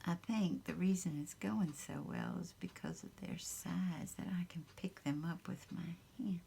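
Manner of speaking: soft unvoiced whisper close to the mic; ASMR whisper. Crafter whispering to her viewers while working, soft whisper. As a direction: whisper it gently, as if not to wake anyone.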